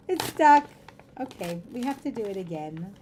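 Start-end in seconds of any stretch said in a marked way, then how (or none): 0.1-0.7 loud, high cry of dismay; exasperated outburst. Real, not acted. Streamer reacting when the envelope sticks, loud dismay. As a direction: yelp it in frustration.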